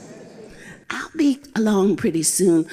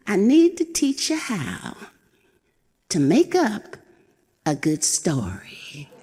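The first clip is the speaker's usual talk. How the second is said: put-on high, chuckling old goat, playful and loving; an old nanny sharing a secret with a laugh; fond old goat; 8 semitones higher than usual. Old goat telling her babies to make up stories, chuckling.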